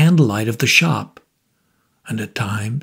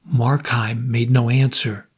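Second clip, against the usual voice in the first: usual speaking voice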